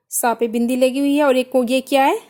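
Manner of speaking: ordinary talk, nothing marked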